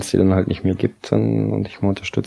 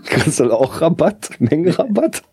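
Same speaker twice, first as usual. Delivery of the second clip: amused; 8 semitones higher, slower than usual. Real, not acted. Joking about bulk discount.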